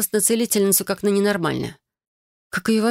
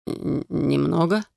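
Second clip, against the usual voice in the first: медленный ошарашенный хриплый переспрос; выдохнуть вопрос, споткнувшись на первом звуке; хрипло, запинаясь; much slower than usual. Acted. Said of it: "хрипло переспросил он". (хриплый растерянный переспрос)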